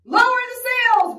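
high, loud storm command; shouting. Acted. Captain orders crew as the storm hits, shouting. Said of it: "Braidbeard shouted".

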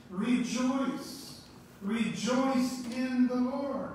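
fervent preaching. Acted. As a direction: preach it out to the congregation, building. Preaching climax, exhorting congregation.

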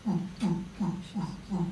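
short repeated "ooh" hoots; imitated taunting hoots. Bully behind the boy's ear, mock hooting. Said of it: "he starts going".

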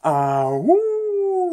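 high, loud imitated wolf howl; playful drawn-out "ah-woo". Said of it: "she whoop back".

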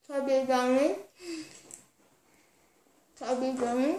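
talking through a mouthful of marshmallows; mumbling with cheeks crammed full; cheeks stuffed full. Real, not acted.

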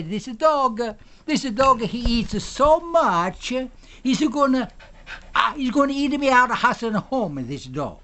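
high, mock Italian broken English; comic Italian accent, pitched high. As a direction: ham up a stage Italian accent worrying about the dog. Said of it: "We can talk in a broken accent".

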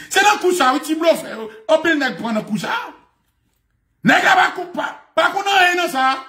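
high, insistent preacher's outburst; heated preaching. Real, not acted. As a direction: pitch it up like an indignant sermon. Preaching at full force. (prèch cho, vwa wo)

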